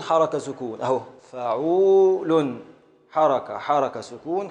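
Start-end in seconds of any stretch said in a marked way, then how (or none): 0.8-2.6 فعول drawn out very slowly, each syllable held; slow syllable-by-syllable scansion. Shown as a demonstration. Teacher stretching the foot to show its beats to the student. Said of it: "يعني حركة".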